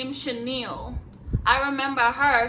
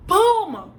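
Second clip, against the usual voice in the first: loud mock punch; 8 semitones higher, 9 dB louder than usual. Acting out the punch.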